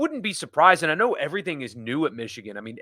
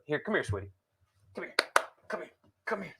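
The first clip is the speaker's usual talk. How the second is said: high, soft coaxing pet talk; calling the dog; 5 semitones higher, 7 dB quieter, faster than usual. Real, not acted. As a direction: coax the dog to come, high and hushed.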